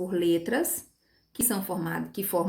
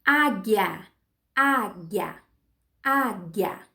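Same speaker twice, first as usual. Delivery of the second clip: "Águia" in two beats, á, then guia; measured, separated halves of "Águia"; syllable by syllable; much slower than usual. Shown as a demonstration. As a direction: beat out "Águia" as á-guia for counting. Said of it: "Duas sílabas".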